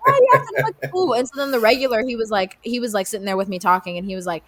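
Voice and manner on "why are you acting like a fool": high, fast exasperated shout; incredulous yell. Retold or imitated. Scolding the customer acting up, high fast incredulous shout. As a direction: fire it at him in disbelief. Arguing back at angry customer.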